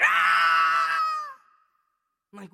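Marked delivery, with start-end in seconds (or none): 0.0-1.0 a loud crying yell in an altered voice; imitated crying shout. Dad mimicking the other son crying out, loud. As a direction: cry out like the older brother. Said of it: "and he's like".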